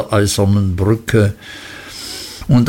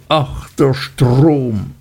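slow, hollow, grieving lament; despairing repetition; 2 semitones higher, much slower than usual. A narrator's reading. Martha repeats her lament at the river, slow and grieving. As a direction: repeat it as if lost in grief. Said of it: "wiederholte immer und immer wieder".

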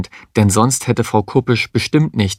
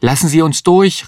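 higher and louder plea to the policeman; urgent call; 7 semitones higher, 3 dB louder than usual. A narrator's reading. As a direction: shout to get through. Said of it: "rief Mario". (drängender Ruf an den Polizisten)

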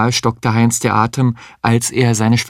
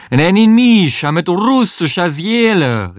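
hammed-up Russian accent, called out high; mock Russian accent; 8 semitones higher, 3 dB louder than usual. Sabine yelling from the bathroom, in an exaggerated Russian accent. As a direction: cry it out as Russian as you can. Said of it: "so russisch sie konnte".

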